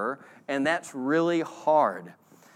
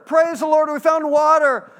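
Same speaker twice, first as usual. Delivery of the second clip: much higher, louder cheer of relief; mock joyful shout; 11 semitones higher, 8 dB louder than usual. Thirsty travelers cheering on finding water, mock joyful. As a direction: shout it like you just found water.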